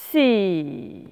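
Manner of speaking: loud, drawn-out hiss; snake hiss. Acted. Pickle scaring Madame E, loud snake hiss. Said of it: "en imitant le serpent".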